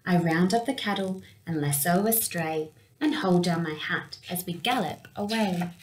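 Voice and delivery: plain reading voice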